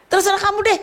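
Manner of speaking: hammed-up fed-up parent. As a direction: push the exasperation to the top.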